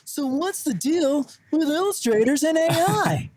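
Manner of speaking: bad stand-up comic impression, high and drawn out; Jerry Seinfeld. Co-host doing a terrible comedian impression about illustrators, exaggerated whiny setup. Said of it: "terrible Seinfeld".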